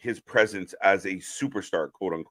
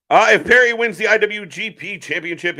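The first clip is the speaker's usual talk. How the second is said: excited; 7 semitones higher, 7 dB louder than usual. Real, not acted. Reacting to a big match stake.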